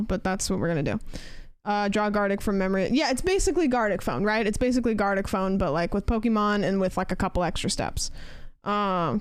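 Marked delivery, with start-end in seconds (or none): none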